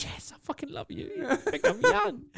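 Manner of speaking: very high, laughing mock delight; gushing through laughter. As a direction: squeal it out while laughing. Gushing reply to being called young.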